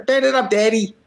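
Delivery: high, excited catchphrase of a hype-man referee; a lightly voiced hype-man catchphrase; Fonzie impression. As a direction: bark it like a wrestling manager hyping his man. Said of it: "That's what he always says".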